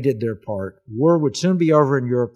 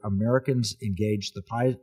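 ordinary delivery

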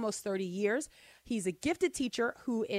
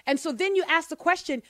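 ordinary talk, nothing marked

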